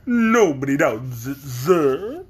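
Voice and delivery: slow pompous big bug; pompous Wogglebug. Acted. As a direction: lecture like a vain insect. Wogglebug replies.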